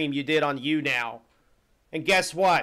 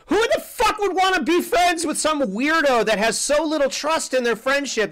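shouted disbelief, pitch jumping high; angry outburst; 8 semitones higher than usual. Real, not acted. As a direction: blast it out in outrage. Angry outburst at contract friends.

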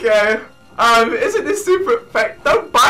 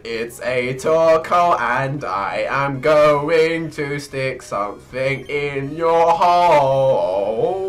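lower, slow, lightly menacing narration; a hint of a villain announcing doom; mock menacing villain; 7 semitones lower, slower than usual. Gamer introducing a Torkoal, mock menacing low voice.

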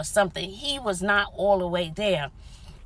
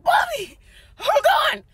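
high, loud alarm; panicked cry to the friend; frantic high call; 6 dB louder than usual. Retold or imitated. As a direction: yell it as you scramble. Man heading back toward car.

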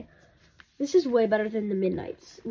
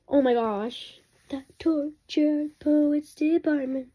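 slow, breathless awe; awed gasp; 5 semitones higher, much slower than usual. Real, not acted. Fan opening the [name] album, slow awestruck gasp. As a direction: gasp and savor each word.

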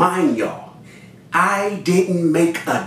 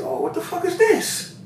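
very high, softish disbelief; retold shock; 4 dB quieter than usual. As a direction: exclaim it high in mock dismay. Retold shock at bad product.

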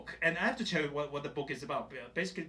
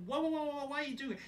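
much higher alarmed whoas; backing off a sudden hug; high startled protest; 12 semitones higher, faster than usual. Retold or imitated. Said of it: "I was like".

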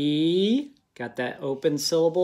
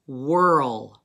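whirl said loudly, with a falling tune, as a spelling word; dictation word; 3 semitones lower, 4 dB louder than usual. Shown as a demonstration. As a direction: say whirl again, loud and clear, for the class to spell. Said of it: "here's a hint with this word".

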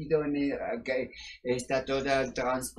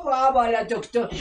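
higher, louder, theatrical French sign-off; gushing French farewell; 4 semitones higher, 6 dB louder than usual. Acted. Sexy doctor signing off the show, gushing in mock French. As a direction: blow kisses as you exit.

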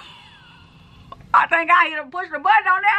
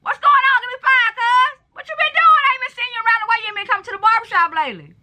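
mistaken for angry; 7 semitones higher than usual. Real, not acted. Hollering at a stranger to catch up, high and excited. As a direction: holler it high and pushy, words tumbling out. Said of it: "Why you angry?".

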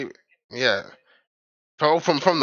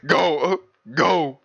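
higher crosswalk sign imitation; crosswalk signal voice; 4 semitones higher, much slower than usual. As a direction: mimic a signal repeating each word separately. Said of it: "the crosswalk sign".

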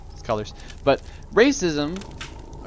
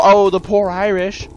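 slow, louder mock sympathy; mock pity; 7 semitones higher, 7 dB louder, slower than usual. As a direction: overdo the pity sarcastically. Mocking pity for Irish.

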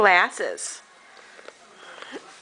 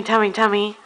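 ordinary talk

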